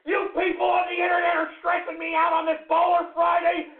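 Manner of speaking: high, strained yelling rant; furious shouting. Real, not acted. Host stressed out by internet people, furious shouting. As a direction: lose it and yell at the audience.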